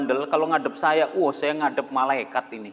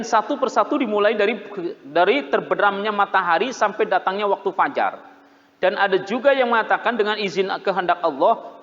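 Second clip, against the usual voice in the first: louder, higher sermon delivery; forceful preaching; 4 semitones higher, 6 dB louder than usual. Acted. Preacher addressing the congregation about angels, full force. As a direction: proclaim it to the congregation.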